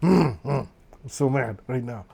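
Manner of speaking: a grinning mock-angry murmur under the breath; smiling while mad. Shown as a demonstration. Showing how his smile hides anger, mock mad. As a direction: sound cheerful while saying you're furious. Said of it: "and maybe".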